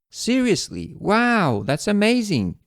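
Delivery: light teacher imitation of delighted disbelief; imitating surprise. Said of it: "showed her surprise and excitement".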